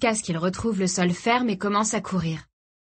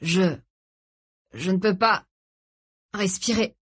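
breathless, broken phrases; halting gasps. A narrator's reading. Father clutching his chest, gasping for air. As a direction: struggle to get each word out. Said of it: "octa Tony".

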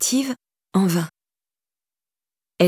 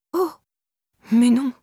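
mildly faltering, higher-pitched protest; stammering; 5 semitones higher than usual. A narrator's reading. Irène learning how late it is, faintly stammering. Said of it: "balbutia Irène".

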